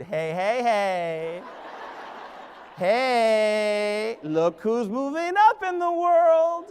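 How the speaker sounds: smug, slow taunt; smug attitude. Retold or imitated. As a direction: gloat at them slowly. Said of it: "walk into faculty meetings with an attitude".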